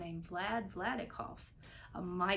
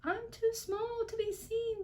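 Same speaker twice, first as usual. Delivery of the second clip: very high tiny voice; tiny Who voice; 13 semitones higher than usual. A narrator's reading. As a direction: speak like someone too small to see. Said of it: "called the voice".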